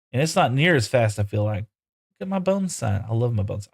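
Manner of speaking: normal talking voice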